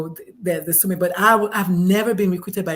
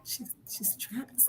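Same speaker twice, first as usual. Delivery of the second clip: whisper; 3 semitones higher, 20 dB quieter, slower than usual. Retold or imitated. Clients whispering about realtor.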